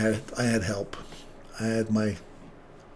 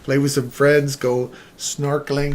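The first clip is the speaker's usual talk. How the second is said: usual speaking voice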